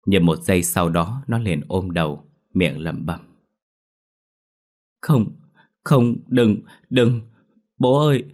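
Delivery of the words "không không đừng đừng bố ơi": put-on terrified child. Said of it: "thái độ run sợ của cháu".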